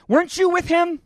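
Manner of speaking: high, loud, pointed question; accusing bystander. Acted. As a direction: call him out as a suspicious stranger.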